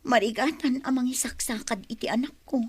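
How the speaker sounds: put-on whispered, breathless lament about her child; hushed confession. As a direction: let it slip out as a whisper. Mother confides her hardship. (agar-arasaas a panagdung-aw)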